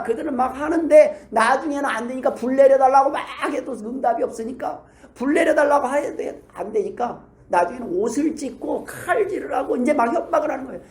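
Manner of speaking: ordinary talk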